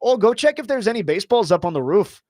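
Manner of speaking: mock a Minnesotan asking you to check the roof; Minnesota accent. Telling the guest how Minnesotans talk, a light Minnesota accent. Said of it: "people in Minnesota".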